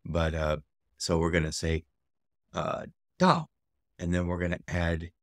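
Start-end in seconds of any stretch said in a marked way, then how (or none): 3.2-3.5 DA starting high and dropping fast; falling tone. Shown as a demonstration. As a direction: say DA with a falling fourth tone. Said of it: "it is a falling tone".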